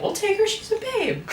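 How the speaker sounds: high, laughing voice for France; mock France. France claiming the daughter in a squeaky laughing voice. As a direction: squeal it like a giddy suitor. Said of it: "France was like".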